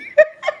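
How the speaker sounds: sudden high-pitched giggle; short shrieking laugh; high squealing laugh. Real, not acted. Bursts out laughing.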